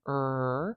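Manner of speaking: er said alone as a bare r; isolated r sound. Shown as a demonstration. Teacher demonstrating the turned R, er alone. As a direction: hold the turned r, er. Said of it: "we use this turned R".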